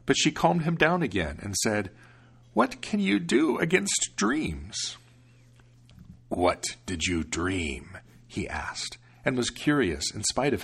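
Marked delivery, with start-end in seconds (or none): 2.5-5.0 put-on high, slow calming old woman; calm him down; soothing grandmother. Grandmother calms devil. 6.3-8.0 lower, slow devil; curious devil. Acted.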